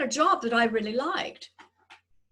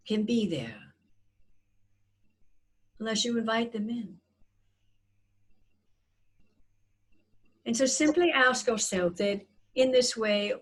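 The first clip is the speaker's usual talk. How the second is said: guided meditation. Acted. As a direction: gently guide their breathing. Leads a breathing meditation.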